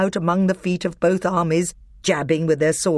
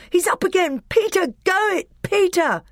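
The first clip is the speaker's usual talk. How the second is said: very high-pitched cheering; excited cry. A narrator's reading. Edmund cheering Peter back on his feet, very high excited cries. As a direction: cheer your brother on from the sidelines. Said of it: "cried Edmund".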